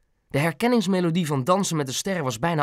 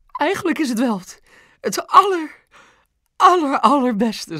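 very high, effusive mother; gushing one-upmanship; 12 semitones higher, 4 dB louder than usual. A narrator's reading. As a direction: top it with ever more emphatic "aller". Mam tops him with more superlatives. (hoog en overdreven uitbundig)

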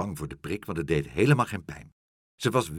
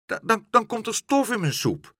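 put-on high, louder child voice; stammering protest; 13 semitones higher, 5 dB louder than usual. A narrator's reading. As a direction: trip over the start of a desperate excuse. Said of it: "riep Aagje".

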